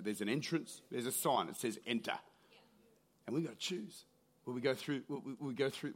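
hushed altar call. Acted. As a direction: draw them in gently, low and quiet. Quiet low altar-call close.

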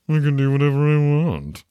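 high, loud, held-up playful proclamation; hammed-up, loud, high-pitched boast; mock triumphant declaration. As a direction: belt it out in mock defiance.

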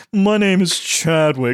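put-on slow, snooty prep boy; posh preppy voice. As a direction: introduce yourself with smug pride. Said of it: "I also love that voice".

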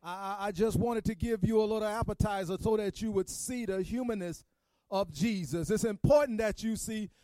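Good Friday preacher pushing toward the climax; preaching. Acted. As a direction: preach it to the congregation, gathering steam.

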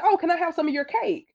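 high-pitched imitation of an attendant; eager asking. Parking attendant asks friend for cake, mock eager.

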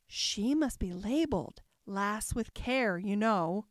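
ordinary delivery

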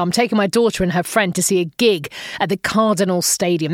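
ordinary talk

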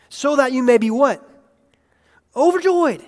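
high and loud, soaring; rising preaching. Real, not acted. Pastor preaches joy to the congregation loudly. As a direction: lift the word Overjoyed up high.

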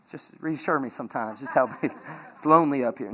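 low, soft pretend plea for reassurance; mock plea. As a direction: fake a lonely cry for help, joking. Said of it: "No, I'm just kidding".

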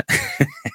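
quick high giggle; a brief amused giggle; short laugh. Real, not acted. Still amused by clip.

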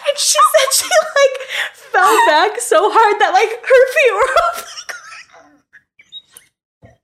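high, cracking up mid-story; storyteller losing it laughing; laughing hard. Real, not acted. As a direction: barely get it out through laughter. Retelling mom's fall, cracking up.

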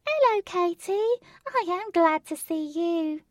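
squeaky friendly bunny voice; high-pitched rabbit voice. Acted. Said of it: "the same voice".

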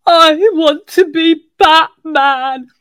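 put-on high, slow, tearful wail of a sobbing boy; crying child. Teacher voicing a crying boy who wants to be Batman, tearful. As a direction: voice the little boy begging through tears.

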